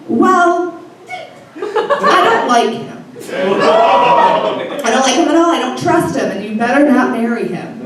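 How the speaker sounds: a hint of a disapproving grandfather; stern dead grandpa. Dead grandpa via medium warning granddaughter off boyfriend, lightly voiced stern grandpa. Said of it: "my dead grandpa said through the medium".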